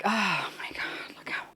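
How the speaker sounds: soft whispered cooing; barely voiced, melting awe; hushed adoration. Real, not acted. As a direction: whisper it, overcome by cuteness. Cooing over the tiny figure.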